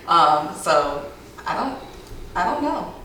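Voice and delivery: ordinary delivery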